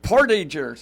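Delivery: Portagers as POR-tidge-ers; English-style Portagers, stressed up front; everyday English pronunciation. Shown as a demonstration. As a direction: repeat Portagers plainly, with "idge" in the middle. Repeats name after "The what?.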